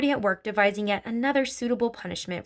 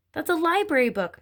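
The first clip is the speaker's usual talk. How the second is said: higher-pitched protesting cry; cried out; 8 semitones higher than usual. A narrator's reading. Matilda protests as her library book is torn, crying out. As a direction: cry out in mild alarm. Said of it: "Matilda cried".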